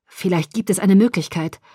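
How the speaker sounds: ordinary delivery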